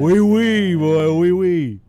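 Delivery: loud, drawn-out teasing whoop; hammed-up, booming mockery; mock French cheer. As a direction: crow it out at a friend. Teasing friend mistaken for French.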